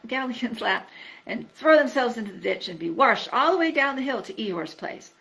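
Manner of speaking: ordinary delivery, nothing marked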